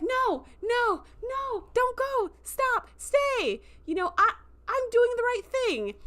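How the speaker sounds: high, pleading whine, drawn out; desperate whine. Retold or imitated. Braca begs the departing delegates to stay, whining. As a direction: beg them not to leave, whining.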